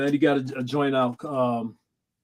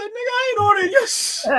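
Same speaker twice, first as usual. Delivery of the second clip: mock refusal. Retelling friend's refusal.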